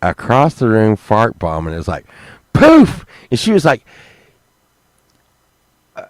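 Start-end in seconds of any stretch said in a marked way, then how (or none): 2.5-3.1 loud, high burst of a poof; poof sound effect. Retold or imitated. Acting out the pillow fart bomb, loud high poof. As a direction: blast the poof like an explosion.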